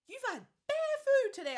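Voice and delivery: high, loud teasing impression; mocking boyfriend voice, sing-song and loud; mock boyfriend tease. Mimicking the baby father teasing her about eating, high and loud.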